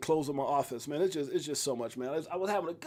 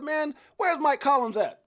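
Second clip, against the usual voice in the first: higher, slow retold question; raised, slow quote; quoting a friend; 8 semitones higher, 5 dB louder, slower than usual. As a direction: mimic the friend asking. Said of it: "He said".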